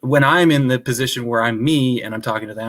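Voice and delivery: ordinary delivery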